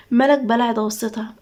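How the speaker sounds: ordinary delivery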